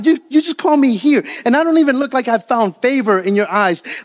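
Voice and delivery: high-pitched, exasperated complaint; Moses. Acted. Overwhelmed preacher complains to the Lord about lacking resources, exasperated protest. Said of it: "Moses is saying this".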